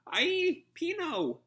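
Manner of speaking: very high, loud yelp of alarm; shrill cartoon shout of alarm; alarmed cry. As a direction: scream her name as she's snatched. Tentacle grabs Pino.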